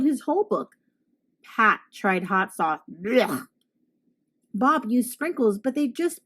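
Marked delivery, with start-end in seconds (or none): 2.9-3.5 a lower gagging blech; disgusted blech. Acted. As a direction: spit it out in disgust. Monster spitting out book.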